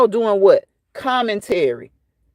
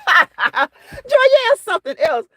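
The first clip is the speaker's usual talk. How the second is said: laughing; 13 semitones higher, slower than usual. Real, not acted. Laughing at a chat comment.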